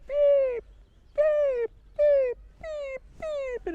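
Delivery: very high, loud, crying chick beeps; baby bird cries. Acted. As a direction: cry out like a cold, lost baby bird. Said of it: "and he was going".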